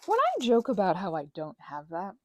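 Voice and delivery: normal talking voice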